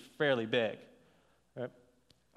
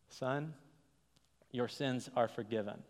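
hushed solemn delivery; 3 semitones lower, 6 dB quieter than usual. A narrator's reading. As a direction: let the words land quietly. Dramatic retelling of Jesus' words.

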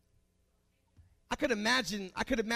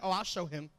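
mock God scheming; 4 semitones lower than usual. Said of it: "He's like".

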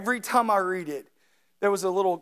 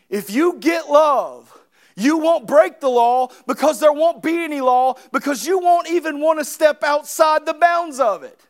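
loud, raised preaching to the congregation; full-force preaching; 7 semitones higher, 9 dB louder than usual. Acted. Pastor urging his church on prayer, preaching loudly. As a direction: drive it home from the pulpit.

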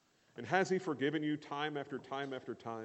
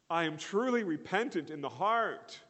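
mock declaration; 7 semitones higher, 5 dB louder than usual. Preacher acts out verbal repentance.

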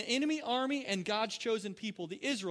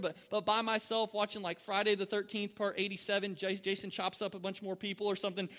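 usual speaking voice

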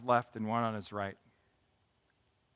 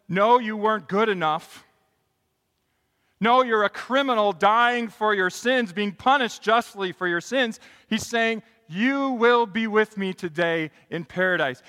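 loud, high preaching; preaching at full force; 6 semitones higher, 9 dB louder than usual. Real, not acted. Pastor to congregation, preaching loud and high. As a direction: preach it hard.

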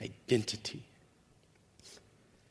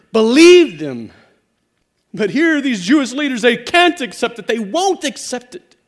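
building exhortation; 7 semitones higher, 13 dB louder than usual. Acted. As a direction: press harder with each command.

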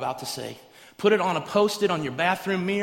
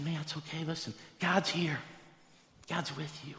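soft, quiet reassurance; soothing dad; 7 dB quieter than usual. Acted. Dad calming his scared son at bedtime, softly reassuring. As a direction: settle a frightened child gently.

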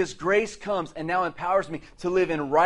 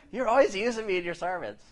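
a light hint of a whiny teenage son; mock the son's protest, lightly; son complaining; 3 semitones higher than usual.

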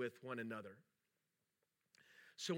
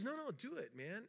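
retold urging, pitched well up; lightly acted, high-pitched insistence; insistent acquaintance; 8 semitones higher, 4 dB quieter than usual. Acquaintance urging him to try the inversion table, insistent. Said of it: "and he goes".

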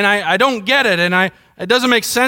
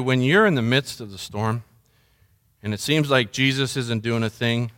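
ordinary talk

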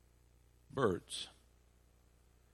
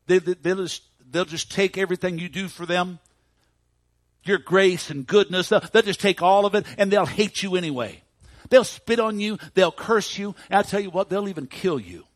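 loud, high, insistent warning; scornful tirade; 7 semitones higher, 10 dB louder than usual. Retold or imitated. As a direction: pile up accusations in a raised preacher's voice. Satan's tirade against people to Jesus.

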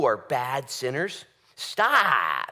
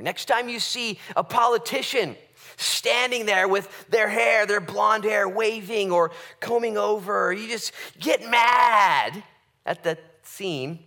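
higher and louder, slowing down to a shout on get mad; fiery preaching; 8 semitones higher, 7 dB louder, slower than usual. Acted. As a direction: build the sermon up into a shout about the politician. Preaching at full force.

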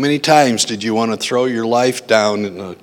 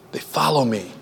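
much softer repeat, "follow" falling gently; soft echo; 9 dB quieter than usual. Shown as a demonstration. Preacher echoing the verse back to his congregation, soft and quiet. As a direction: let it drop quietly, almost to yourself. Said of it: "and what?".

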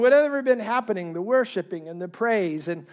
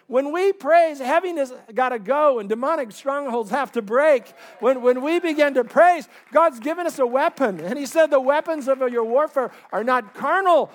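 higher, louder sermon delivery; preaching at full force; 5 semitones higher, 5 dB louder than usual. Real, not acted. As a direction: drive each praise line home to the congregation.

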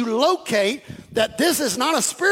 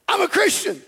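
mock proud claim; 8 semitones higher than usual. Mock proud self-talk.

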